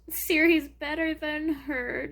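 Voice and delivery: ordinary talk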